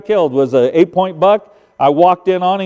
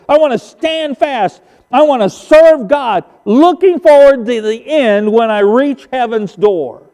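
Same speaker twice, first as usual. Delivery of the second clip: higher, impassioned sermon cadence; fervent preaching; 6 semitones higher than usual. Acted. Pastor urges the church to stand fast for God, fervent preaching. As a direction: build phrase on phrase toward a climax.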